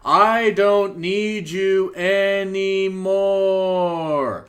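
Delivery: slow, high, hammy wrestling promo; overacted line reading. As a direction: drag out each word, badly acted. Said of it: "who she took her acting lessons from".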